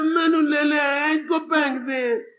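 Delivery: put-on high, slow whining toddler; whiny child. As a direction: beg like a jealous toddler. Child voiced pleading.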